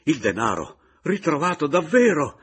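loud, high, amazed outcry; jubilant exclamation. A narrator's reading. Caporale thanking God for the found money, exclaiming. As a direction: exclaim in joyful disbelief. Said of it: "esclamò levando le braccia".

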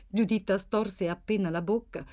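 plain reading voice

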